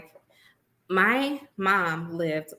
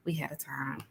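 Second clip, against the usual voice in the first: breathy whisper of a fond memory; 6 semitones lower, 13 dB quieter than usual. Real, not acted. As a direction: whisper it like a secret you treasure. Fond memory repeated softly.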